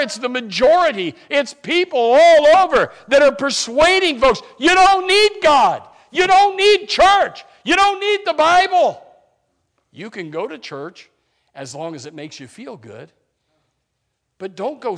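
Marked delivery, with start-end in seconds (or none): 1.3-9.0 loud, much higher mocking the world's pitch; full-force preaching. Preacher voicing the world's persuasion to the church, loud preaching. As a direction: hurl the world's lies back at them.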